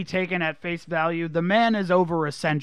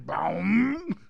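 long, drawn-out drum boom by mouth; imitated timpani drop; 2 semitones higher than usual. Mocking the movie's sound effect, imitated timpani boom. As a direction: let the boom swoop like a timpani.

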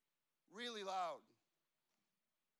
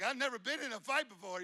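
loud, higher mock-panicked voice; imitated nervous panic; 5 semitones higher, 11 dB louder than usual. Matt's nervousness about fighting for his pastor, loud mock panic. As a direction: play a jittery, unwilling defender. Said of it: "His, his little arm's shaking".

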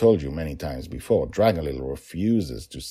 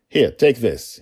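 loud, higher, decisive; father handing something over; brisk offer; 7 semitones higher, 9 dB louder than usual. A narrator's reading. Father hands over torch briskly.

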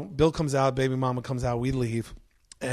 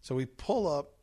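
ordinary talk

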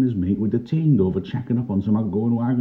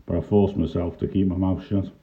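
ordinary delivery, nothing marked

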